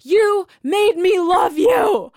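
slow, high, loud re-enacted scream; drawn-out screamed accusation, performed; retold scream. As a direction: wail it accusingly, acting out a bar meltdown. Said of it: "I was screaming at the top of my lungs in this bar".

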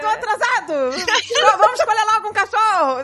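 aguda e rindo de si mesma; disfarce encenado. Retold or imitated. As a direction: finge pressa de forma exagerada, com riso. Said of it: "Aí eu comecei a disfarçar". (disfarçando mal, voz aguda)

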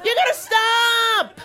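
high exasperated yell; shouting. Real, not acted. Yelling at joggers.

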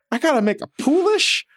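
mock outraged squeal. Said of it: "I was like".

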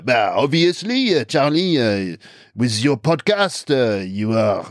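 slow, hammed-up French accent; exaggerated pretentious French intellectual, stretched endings; outrageous French impression. French person giving Charlie their opinion, outrageous French accent. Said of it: "that was an outrageous French impression I did then".

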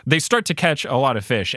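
ordinary delivery

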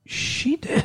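soft, breathy acted-out aside; hushed mock reveal. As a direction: let it slip under your breath, imitating him. Acting out the guy's quiet reveal.

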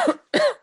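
sudden sharp cough; harsh hacking cough; coughing fit. Real, not acted. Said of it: "I'm coughing".

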